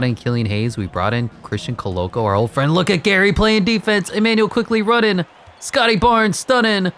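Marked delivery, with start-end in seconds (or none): 2.7-6.9 high, hyped running commentary; elated high-pitched sportscaster call; excited play-by-play. Real, not acted. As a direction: call the action in a rising, animated voice. Calling a defensive play in game.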